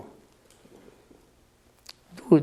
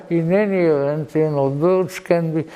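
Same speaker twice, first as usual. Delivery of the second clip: normal talking voice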